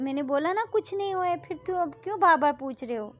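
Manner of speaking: fed up, push her away loudly; girl snapping at her friend to stop asking; irritated friend. Acted. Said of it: "फिर आगे से जो हमारी कविता बोली".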